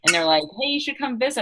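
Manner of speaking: ordinary delivery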